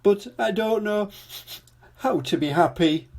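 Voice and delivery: high, sniffly king voice; sniffling king. A narrator's reading. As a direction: sniff through the words. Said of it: "sniffed the king".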